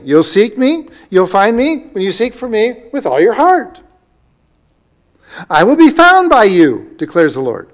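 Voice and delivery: loud, high, ringing pulpit reading; fervent scripture proclamation. Acted. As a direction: proclaim God's promise at full voice.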